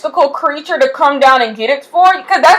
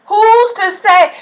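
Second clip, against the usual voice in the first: "Who's to say?" drawn out slow and high; slow high challenge; 8 semitones higher, slower than usual. Shown as a demonstration. Vlogger challenging doubters, slow and high. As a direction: dare them to name who says so. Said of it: "rhetorical question repeated".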